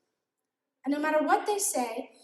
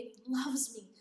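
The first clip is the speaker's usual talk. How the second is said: whispered; 2 semitones lower, 7 dB quieter than usual. Real, not acted. Tender line about God's love.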